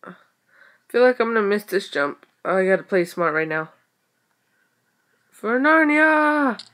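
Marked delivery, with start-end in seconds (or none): 5.3-6.6 slow, higher, ringing war cry; mock battle cry. Gamer psyching herself up before a jump, mock battle cry. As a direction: charge like a hero.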